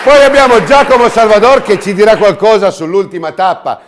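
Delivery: loud, high, booming presenter; showy game show host. Acted. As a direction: hype up the crowd like a TV host. Host introduces the guests.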